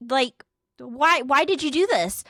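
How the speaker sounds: acted-out incredulous questioning; exasperated interrogation. Retold interrogation of cheating friend.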